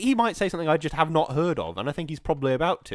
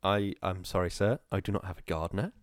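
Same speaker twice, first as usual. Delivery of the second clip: mock poor servant; 8 semitones lower, 5 dB quieter than usual. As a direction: meekly play the poor servant from the film. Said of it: "that's a quote from the film".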